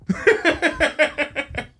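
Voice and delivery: high-pitched run of chuckles; rapid, high burst of giggling laughter; laughing. Real, not acted. Teller amused by cutting his own joke short, laughing.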